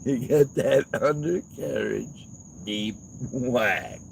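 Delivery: slow mock-impressed echo. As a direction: parrot it back slowly to tease. Mocking echo of line.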